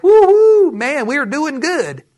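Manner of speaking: high, drawn-out whoop of success; mock elation. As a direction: whoop it up, then stretch the boast. Retelling workers' early elation.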